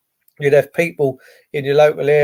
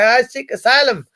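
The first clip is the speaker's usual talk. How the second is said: mock asylum seeker; 6 semitones higher than usual. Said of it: "come back into the country ... and say".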